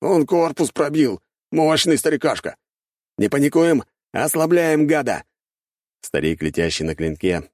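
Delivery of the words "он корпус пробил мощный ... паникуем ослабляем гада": high, raised voice over the fight; rally the crew under fire; urgent battle shout. A narrator's reading. Gunners rallying each other against the old man, urgent shouting.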